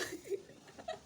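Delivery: airy high-pitched giggle; quick airy giggle; high breathy laugh. Real, not acted. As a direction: let out a light high laugh. Laugh after relief.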